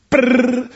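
a quick rolled-lip brrr for the spear; spear whoosh noise. Retold or imitated. Pastor imitating the spear flying past David. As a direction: make the spear fly past.

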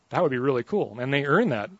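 ordinary talk

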